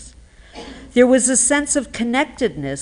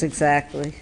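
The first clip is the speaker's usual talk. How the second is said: ordinary talk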